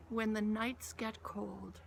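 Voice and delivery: ordinary talk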